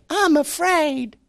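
put-on high, slow, timid child's voice; scared child. Preacher voices a scared child saying it. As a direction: squeak it out like a frightened little kid.

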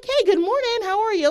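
high-pitched, imitated cheery mom; super happy. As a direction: chirp it like an always-happy mom. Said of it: "she's super happy".

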